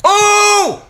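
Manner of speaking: very high, loud, drawn-out oh; long high yell of astonishment; shocked shout. Real, not acted. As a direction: cry out in shock at the footage. Reacts to footage he replays.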